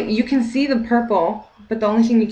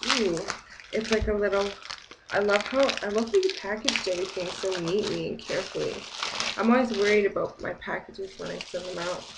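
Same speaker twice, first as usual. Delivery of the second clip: ordinary delivery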